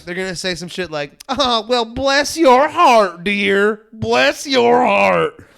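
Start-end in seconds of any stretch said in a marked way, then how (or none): 1.3-5.4 loud, high, slow syrupy fake sympathy; mock passive-aggressive Southerner. Man mimics Southerners hiding contempt, loud passive-aggressive drawl. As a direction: imitate fake Southern niceness, stretching each word way up high. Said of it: "they're gonna be passive-aggressive".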